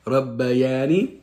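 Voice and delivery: "Rabbayani" very slow, every syllable separate, rising at the end; slow model recitation. Shown as a demonstration. Mother teaching her son the dua, very slow clear recitation. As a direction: stretch "Rabbayani" out so the child can follow. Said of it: "One more time".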